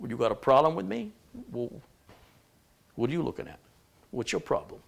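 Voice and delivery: mock-confrontational New Yorker; New York accent. Streamer kidding that New Yorkers talk in questions, mock New York accent. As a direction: needle them in a put-on New York accent. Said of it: "People from New York, they talk".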